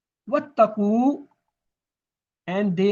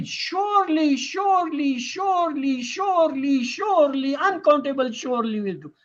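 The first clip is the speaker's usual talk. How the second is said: each "surely" pitched high and hammered out; piled-up emphasis; 6 semitones higher than usual. Shown as a demonstration. Teacher showing triple stress to students, insistent on every "surely". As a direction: keep hitting SURELY in a high, insistent chain.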